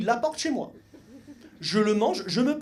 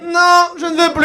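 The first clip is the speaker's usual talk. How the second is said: loud, slow, higher mock cry of refusal; girl's mock protest; 7 semitones higher, 12 dB louder, slower than usual. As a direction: ham up a horrified girl yelling no. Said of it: "elle fait".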